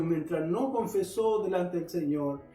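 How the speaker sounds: usual speaking voice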